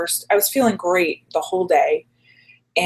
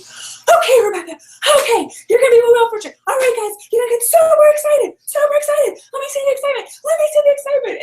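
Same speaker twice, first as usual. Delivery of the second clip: much higher, hyped host cheer; peppy Jackie; 5 dB louder than usual. Retold or imitated. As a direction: pump up the contestants like an overeager hostess. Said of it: "This is Jackie".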